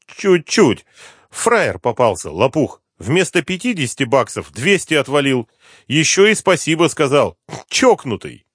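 amused addict informant. Acted. Addict confesses a sale to the inspector, high voice cheering up. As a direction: play the informant relishing his little swindle.

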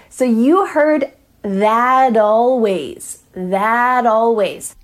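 slow demo of that always with the T of that tapped into a D, tha-dalways; flapped T between words. Shown as a demonstration. Coach slowly modelling the linked flap T across words. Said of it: "This also happens between words".

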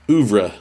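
"oeuvre" said OOV-ruh; newly learned word "oeuvre", OOV-ruh; practised pronunciation. Shown as a demonstration. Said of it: "forced to say it out loud".

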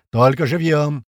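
emphatic callout; shouted order. A narrator's reading. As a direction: call it out firmly to the squad. Commander adds a shouted order.

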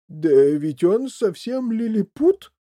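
loud, high, drawn-out amazement; exclaiming. A narrator's reading. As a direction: exclaim it slowly, astounded. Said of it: "воскликнул мистер Ти Ви".

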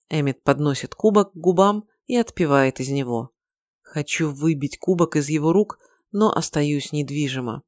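usual speaking voice

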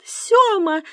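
very high, sharp exclamation; exclaiming. A narrator's reading. Wife interrupts old man giving out the number, exclaiming. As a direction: cry out his name high in protest. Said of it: "воскликнула Циля".

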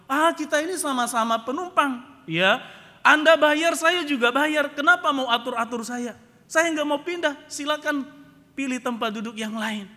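loud, high, indignant refusal; Pak Mukidi. Retold or imitated. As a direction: argue back angrily as the stubborn passenger. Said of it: "Pak Mukidi marah-marah". (marah-marah, ngotot dengan suara keras)

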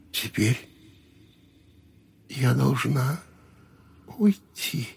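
put-on high, soft sad girl's voice; a fading ghost saying goodbye; ghostly woman. A narrator's reading.

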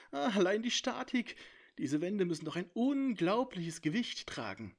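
high, amazed musing; marvelling dragon. A narrator's reading. Dragon marvels at the impossible indoor world, high and amazed. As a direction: wonder at the impossible building.

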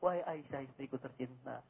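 tender address. Retold or imitated. The Prophet tenderly calling his wife Aisyah, soft and loving. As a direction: say it gently, like a loving husband. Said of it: "Apa kata Rasul kita?".

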